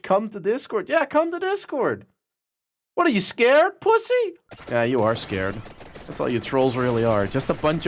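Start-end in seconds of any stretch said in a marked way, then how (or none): 0.0-4.3 very high sing-song goading; mock taunt. Streamer daring a troll into Discord, high goading. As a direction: goad him like a kid.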